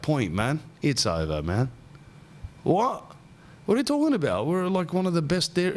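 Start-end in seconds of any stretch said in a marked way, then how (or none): 2.6-5.7 high mock disbelief; shocked protest. Dumped man reacting, high mock shock.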